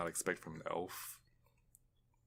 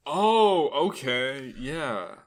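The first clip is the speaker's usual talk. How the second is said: high, loud drawn-out oh; real surprised realization; dawning recognition; 6 semitones higher, 10 dB louder, much slower than usual. Real, not acted. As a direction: it suddenly clicks, voice jumps up. Sudden recognition of an actress.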